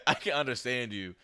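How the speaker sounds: usual speaking voice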